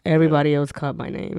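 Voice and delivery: ordinary talk, nothing marked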